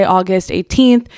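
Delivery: ordinary talk, nothing marked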